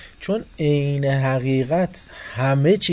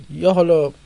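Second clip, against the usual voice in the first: loud exclamation; 7 semitones higher, 10 dB louder than usual. Real, not acted. Emphatic exclamation.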